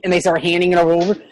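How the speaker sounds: normal talking voice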